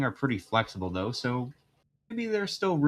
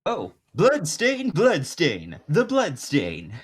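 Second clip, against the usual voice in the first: high, loud, slow jazzy showman; jazz musician voice; 5 semitones higher, 8 dB louder, much slower than usual. Acted. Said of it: "No, it was more of a country accent".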